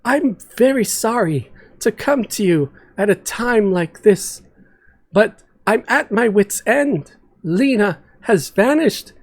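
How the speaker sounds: worried Yara. Acted. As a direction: beg for any news of Lena. Yara pleads about missing Lena.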